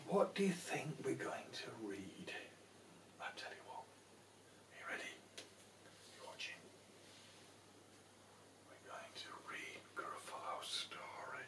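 hushed secret to the little ones; conspiratorial whisper; suspenseful whisper. Pretend. Building suspense before the book.

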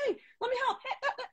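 very high, laughing squeal; over-eager helper. Acted. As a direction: send up an eager volunteer, pitch shooting way up. Acting out their over-eager offers to help.

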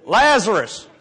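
loud second try at raising him; mock summoning shout. Second man trying at Lazarus' tomb, acted loud summons. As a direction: bellow it with hammed-up authority. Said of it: "Okay, you try".

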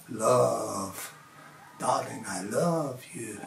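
low, soft and slow declaration; low tender love. Shown as a demonstration. Telling his wife he loves her, low and slow. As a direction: murmur it lovingly. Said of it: "You can do it all sorts of ways".

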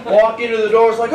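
normal talking voice

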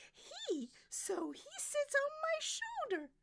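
very high, loud diva voice; retorting soprano. Acted. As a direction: settle the argument like a diva.

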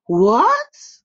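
high loud startled "What?"; surprised shout. A narrator's reading. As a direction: gasp it out in shock. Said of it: "the boys exclaimed in surprise".

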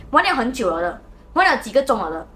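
ordinary delivery

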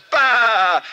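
loud, high goat bleat. Retold or imitated. The goat interrupts the worship, a loud high bleat. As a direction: blurt a sharp bleat. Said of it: "And the goat says".

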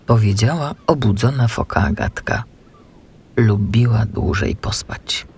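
usual speaking voice

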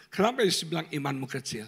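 ordinary delivery

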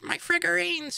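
very high-pitched little nerd voice; high, put-on mocking voice. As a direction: squeak it out like a precious hobbyist. Mocking figurine-painting nerd.

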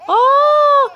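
high, loud pretend surprised "aa"; a sudden startled cry at the fever; surprised gasp. Mother finding her baby very hot, startled shout.

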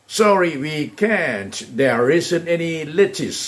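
drawn out, words spaced; slow drill model; slow model answers. Shown as a demonstration. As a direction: stretch every word for students. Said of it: "พูดว่า".

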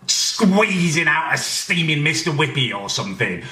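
usual speaking voice

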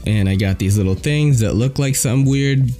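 usual speaking voice

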